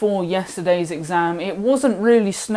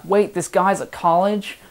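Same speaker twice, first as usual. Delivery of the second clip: hammed-up American accent, higher and louder; bad American accent; 3 semitones higher, 5 dB louder than usual. Confused American viewer asking about college, in a bad American accent. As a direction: put on a badly faked American accent. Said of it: "Really bad American accent".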